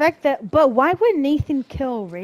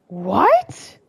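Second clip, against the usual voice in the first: loud "what" shooting up in pitch; stunned disbelief; 3 semitones higher, 9 dB louder than usual. Real, not acted. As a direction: blurt it out, utterly floored. Stunned by villain reveal in game.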